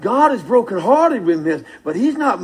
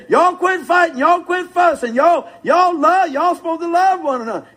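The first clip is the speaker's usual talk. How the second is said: mock scolding parent; 6 semitones higher than usual. Preacher imitating scolding parent.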